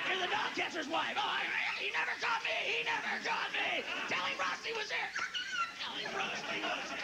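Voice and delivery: hammed-up dog boasting; excited stray dog role. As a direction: play the proud stray.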